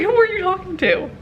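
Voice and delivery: high-pitched mock indignation; indignant comeback. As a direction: throw it back, high and affronted. Retold argument with yelling agent.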